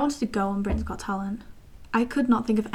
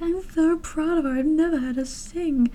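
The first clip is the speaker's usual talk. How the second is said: proud parents; 6 semitones higher than usual. Retold or imitated. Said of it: "they were like".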